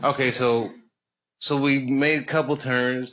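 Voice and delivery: normal talking voice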